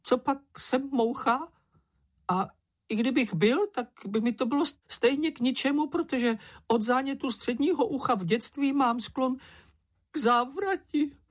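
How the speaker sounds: very high, squeaky whining; high-pitched fretful little creature; Čenich, tearful complainer. A narrator's reading.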